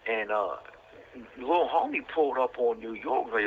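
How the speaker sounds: ordinary delivery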